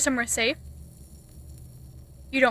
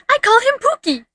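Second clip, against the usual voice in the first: bright, louder and higher pet name; excited; 8 semitones higher, 7 dB louder, faster than usual. Real, not acted. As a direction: announce the pet's name with glee. Introducing her pet sea dragon.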